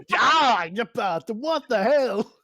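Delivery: high, loud outraged squawk; indignant goblin yell. Acted. Goblin yelling at the player who bit its hand, high and loud. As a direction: yell it like a robbed little pest.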